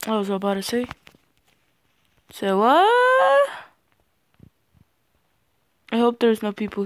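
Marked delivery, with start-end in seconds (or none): 2.3-3.5 rising squeal on the last word; squealed disbelief. Real, not acted. Gamer reacting mid-game, high squealed question. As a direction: shoot way up high on a long final word. Breaks off into mock exclamation.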